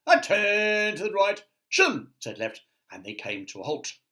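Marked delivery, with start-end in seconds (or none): 0.1-2.1 very high, loud parade-ground call; drill command. Acted. Boots drilling themselves, very high loud commands. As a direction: bark it like a tiny sergeant.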